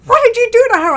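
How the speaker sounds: can't believe how happy she is; amazed question. Retold or imitated. Director asking what was done to the actress, amazed.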